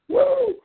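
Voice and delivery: high wrestling-promo woo, yelped out; Ric Flair woo. Retold or imitated. Host imitating Ric Flair in a wrestling promo, piercing high woo.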